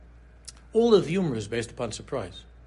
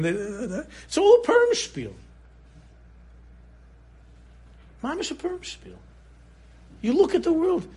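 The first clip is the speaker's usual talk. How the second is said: fervent preaching; 7 semitones higher, 4 dB louder than usual. Acted. Preaching Purim spiel refrain.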